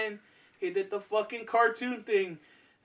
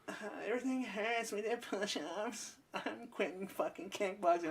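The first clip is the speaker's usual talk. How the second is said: whiny quitter; 4 semitones higher, 9 dB quieter than usual. Retold or imitated. Classmate whining he quits.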